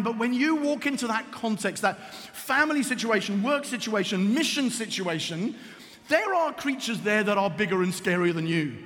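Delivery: ordinary delivery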